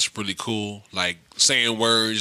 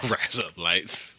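laughing slow mock Aussie; 6 semitones lower, 4 dB quieter, slower than usual. Shown as a demonstration. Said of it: "have them say".

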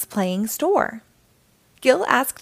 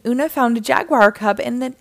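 usual speaking voice

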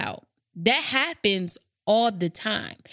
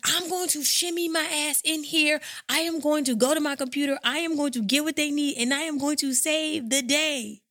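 higher, heroic mock resolve; lightly hammed-up hero vow; mock superhero; 7 semitones higher than usual. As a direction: play it like a caped hero saving the day. Heroic self-quote, cape flying.

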